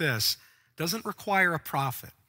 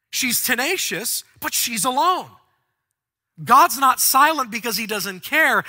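loud, high, rising sermon voice; full-force preaching; 6 semitones higher, 7 dB louder than usual. Acted. Preacher stirring the church over her loneliness, preaching at full force. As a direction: preach it hard to the congregation.

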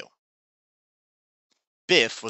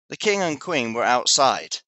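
ordinary delivery, nothing marked

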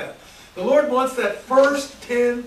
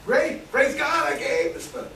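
joyful praise; 7 semitones higher than usual. Retold or imitated. Joyful aside while giving.

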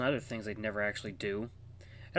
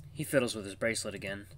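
usual speaking voice

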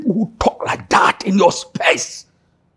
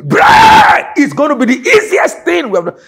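preaching shout; 9 semitones higher, 6 dB louder than usual. Real, not acted. Preacher proclaiming victory, shouting.

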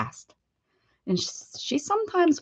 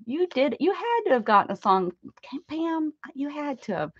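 ordinary talk

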